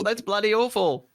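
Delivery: jokey fake Australian accent; terrible Australian accent. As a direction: ham up the Australian. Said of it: "my Aussie accent".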